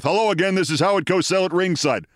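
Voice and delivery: imitating an actor badly mimicking a ringside announcer; big-mouth announcer, unconvincingly done; Walter Matthau. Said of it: "Now the big mouth".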